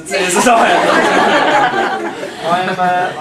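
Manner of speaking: laughing at his own joke. Real, not acted. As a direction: chuckle through the words.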